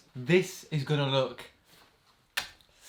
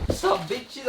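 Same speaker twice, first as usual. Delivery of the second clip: high put-on voice; 9 semitones higher than usual. Box vibrating, odd greeting.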